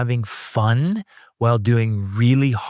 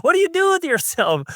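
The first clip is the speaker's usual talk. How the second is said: much higher, slowed disbelief; mocking incredulity, pitched way up; incredulous mock retort. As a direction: squeak out your disbelief at the whiner.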